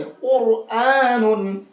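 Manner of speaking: slow "कुरआनुन", "aa" held long; heavy Ra. Shown as a demonstration. Teacher repeating the correct reading, heavy Ra. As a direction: round the first syllable of "कुरआनुन" fully. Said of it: "ऐसे पढ़ेंगे".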